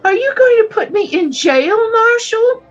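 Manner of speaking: frightened question. Acted. Frightened young woman asking the marshal about jail, high fearful plea. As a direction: ask in a rising panic.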